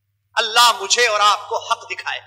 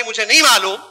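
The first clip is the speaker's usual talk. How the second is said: ordinary talk, nothing marked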